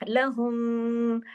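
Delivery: very slow chanted recitation; Quran recitation. Shown as a demonstration. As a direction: recite with idgham, drawn out. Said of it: "इदगाम जो होता है". (धीमी तजवीद वाली तिलावत)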